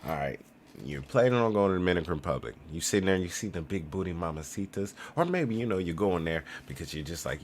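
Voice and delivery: normal talking voice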